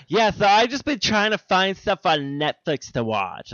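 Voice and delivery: high, slow mocking whine; mocking co-host. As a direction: mimic the lazy co-host mockingly. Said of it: "you're like, you're like".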